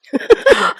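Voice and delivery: sharp, high mock gasp; shocked gasp. Mom gasping when her son blurts it out in class. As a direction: gasp in comic horror. Mom horrified at son's request.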